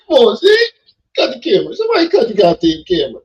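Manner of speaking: loud pleading through fake sobs; hammed-up weepy demand; mock tearful. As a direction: beg them to cut the camera while hamming up crying. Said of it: "make me emotional".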